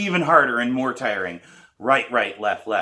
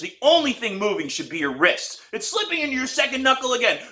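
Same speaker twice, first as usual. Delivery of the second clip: higher, louder stream of corrections; coaching teacher; 10 semitones higher, 3 dB louder than usual. A narrator's reading. Teacher correcting the student's grip, insistent coaching. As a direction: drill her on the grip. Pete's rapid instructions.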